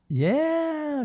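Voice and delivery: high, drawn-out squeal of a yes; like a kid in a candy store; imitating childlike delight. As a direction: play a thrilled mother. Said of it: "She's like a little kid in a candy store".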